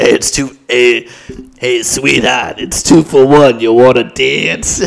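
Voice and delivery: loud, hammed-up old stripper with a cigarette; exaggerated smoker's come-on, pushed loud. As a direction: overdo a chain-smoking old stripper's sales pitch. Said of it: "And a cigarette".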